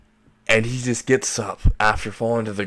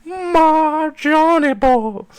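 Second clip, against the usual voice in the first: very high, drawn-out squealed wail; loud mock falsetto shriek of agony; screaming little girl; 5 dB louder, much slower than usual. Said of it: "screaming like a little girl".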